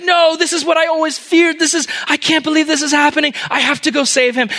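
very high, panicked shout; anguished outcry. Acted. King sees his only son at the stake, anguished outcry.

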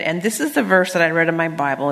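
usual speaking voice

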